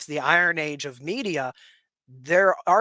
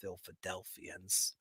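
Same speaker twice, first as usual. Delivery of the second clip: low, slow, much softer menacing drawl; mock sinister rival; 10 semitones lower, 16 dB quieter, much slower than usual. Teasing Pittsburgh's rivals across the state, in mock menace. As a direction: growl the name like a cartoon villain, playfully. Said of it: "I'm just kidding".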